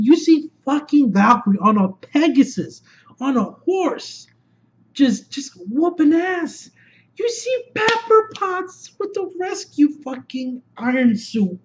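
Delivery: high-pitched fan hype; excited gushing. Real, not acted. Fan recapping heroes in battle, high thrilled gushing. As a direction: pitch climbing with each new hero.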